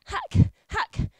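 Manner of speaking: high, loud mock chopping sounds; imitated hacking. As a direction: chop out each hack sharply.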